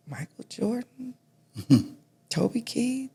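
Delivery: hushed, almost whispered names; awed hush. Retold or imitated. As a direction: breathe the famous names in quiet awe. Son reading contacts in awe.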